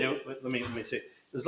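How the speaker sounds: thinking aloud. Real, not acted. Hitter trying to recall another player's stance, thinking aloud quietly. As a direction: drop the volume as if working it out privately.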